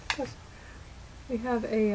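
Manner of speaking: ordinary talk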